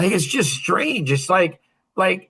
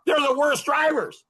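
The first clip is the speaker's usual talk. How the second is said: emphatic shout; 7 semitones higher than usual. Real, not acted. Emphatic claim about bad drivers.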